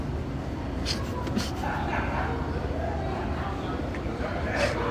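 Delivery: high unvoiced giggling fit; long airy high giggle; long breathless giggle. Real, not acted. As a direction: giggle helplessly, embarrassed. People staring at him.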